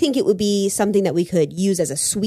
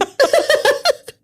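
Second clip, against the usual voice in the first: shrieking laugh, much higher; high burst of laughter. Real, not acted. Bursts out at the bear joke.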